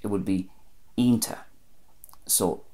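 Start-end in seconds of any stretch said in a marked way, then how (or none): 1.0-1.4 "ionta" as EEN-ta; Ulster pronunciation. Shown as a demonstration. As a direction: say "ionta" with no ch at the end. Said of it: "lose the ch at the end".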